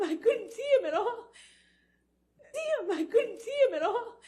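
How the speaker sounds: real tears, crying through the words. Real, not acted. Recalling the moment she no longer saw her husband, breaking into real sobs.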